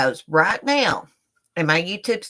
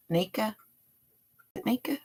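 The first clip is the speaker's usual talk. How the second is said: quiet call; 3 semitones higher, 12 dB quieter than usual. Real, not acted. Calling quietly to someone.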